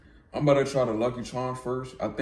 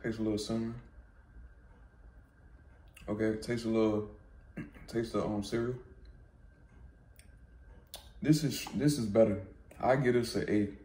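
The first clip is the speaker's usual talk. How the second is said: chewing while talking; eating between words; mouth full of ice cream; 3 dB quieter than usual. Real, not acted. Seen 1: Spoon in bowl, spoon to mouth at 4.1–4.5, lips working.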